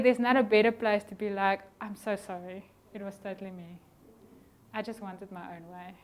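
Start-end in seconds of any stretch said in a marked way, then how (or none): 1.8-5.9 softer, humble confession; sincere apology. Acted. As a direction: own the fault gently. Apologizing after a fight.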